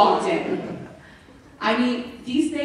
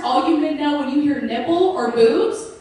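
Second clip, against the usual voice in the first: usual speaking voice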